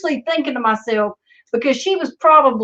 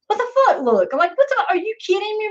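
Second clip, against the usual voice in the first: incredulous look; 10 semitones higher than usual. Retold or imitated. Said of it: "I'm like".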